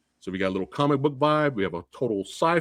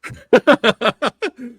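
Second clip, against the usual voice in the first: laughing off anger; 7 semitones higher, 5 dB louder than usual. Real, not acted.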